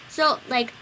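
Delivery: usual speaking voice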